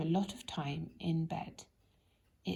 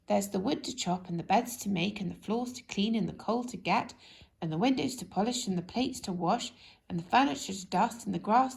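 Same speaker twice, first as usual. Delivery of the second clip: rattling off chores. Acted. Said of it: "added Mister Busy".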